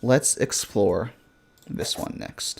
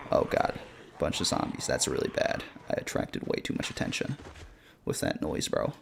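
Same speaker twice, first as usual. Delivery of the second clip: hushed whisper, spooked by zombies; 10 semitones lower, 10 dB quieter than usual. Real, not acted. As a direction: whisper it, scared of being heard. Spotting a zombie horde.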